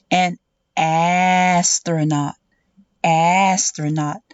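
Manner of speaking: slow "astronaut" with a long first "a"; sounding out the word. Shown as a demonstration. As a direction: stretch the opening vowel of "astronaut". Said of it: "when we say".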